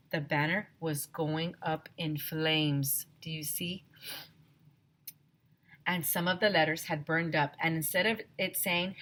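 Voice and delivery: usual speaking voice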